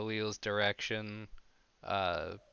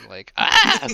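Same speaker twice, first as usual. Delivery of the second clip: mock pained cry; 14 dB louder than usual. Retold pain cry.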